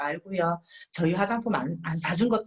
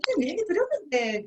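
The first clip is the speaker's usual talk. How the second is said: higher, sighing excuse; fussy apology; 8 semitones higher than usual. Retold or imitated. Partner excusing to the teacher, fussy. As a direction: complain with a sigh. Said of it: "막 이렇게".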